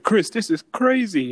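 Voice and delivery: talking to a friend who turns, hammed-up alarm; mock shock. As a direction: gasp it at your friend.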